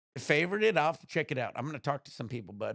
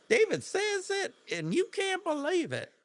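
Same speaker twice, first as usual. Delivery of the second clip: Southern accent. Shown as a demonstration. Showing chat his Southern accent, hammed up. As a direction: ham up a Georgia accent. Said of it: "I could probably do a good Southern".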